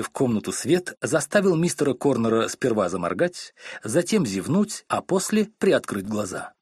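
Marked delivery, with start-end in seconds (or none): none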